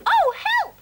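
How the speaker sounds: high, loud shriek. Acted. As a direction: shriek in panic like a frightened mouse. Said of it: "shrieked Mum".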